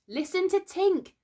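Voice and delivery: high, slow call for attention; drawn-out high announcement; urgent call. A narrator's reading. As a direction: draw everyone's ear, pitched high. Boy calls attention to wailing.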